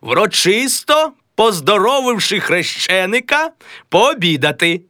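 a lightly played stage voice; character voice. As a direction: read the line in character. Character line read in role.

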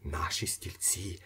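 unvoiced excited whisper. A narrator's reading. Ippolit's inner voice, whispered. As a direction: breathe it in disbelief. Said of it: "кричав".

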